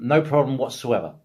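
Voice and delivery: ordinary talk, nothing marked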